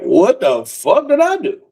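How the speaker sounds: high, drawn-out disbelief; bewildered protest. Retold or imitated. Man with cop's gun on him, high bewildered protest. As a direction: stretch it out slowly in high-pitched bafflement.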